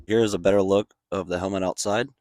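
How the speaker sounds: usual speaking voice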